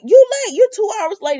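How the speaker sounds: high, loud mock scold of a grumpy elder; scolding old man. [name] scolding Sonny for being late, mock indignant. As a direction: bark it at him like a cranky grandfather.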